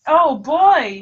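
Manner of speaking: normal talking voice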